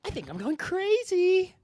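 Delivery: high, slow unhinged whisper of madness; psychopath impression. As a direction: crack into a creepy high madman voice. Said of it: "can we each do our best psychopath impression?".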